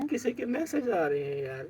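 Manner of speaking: high, tearful wail; distressed, high-pitched crying voice. Real, not acted. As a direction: plead through real sobs. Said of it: "کیوں رو رہے تھے".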